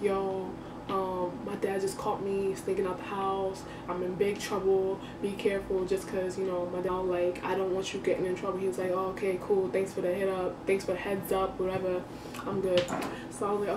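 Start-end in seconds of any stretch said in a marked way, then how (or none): 0.0-8.6 hushed warning. Retold or imitated. Sneakily warning her friend on the computer, softly. Secretly messaging friend at night. 8.9-12.8 softer, offhand and unbothered; soft casual reply. Retold or imitated. Friend answering her warning, quietly casual.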